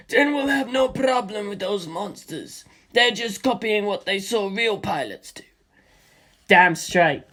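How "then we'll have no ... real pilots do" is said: a slightly raised, easy-going pilot; a hint of a confident comrade; lightly voiced Stephen. A narrator's reading.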